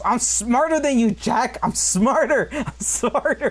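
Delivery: high, slow, insistent gloating; Dennis Hopper's villain. Retold or imitated. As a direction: taunt him like a smug madman. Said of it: "just the way fucking Dennis Hopper delivers his lines".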